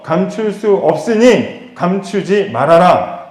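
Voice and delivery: slow, deliberate admonition; measured, weighty preacher's emphasis; solemn quoted command. Acted. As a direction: spell out the command phrase by phrase. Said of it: "이 얘기를 하고 계시는 거예요".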